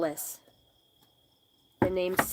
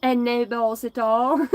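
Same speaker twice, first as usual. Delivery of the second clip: ordinary talk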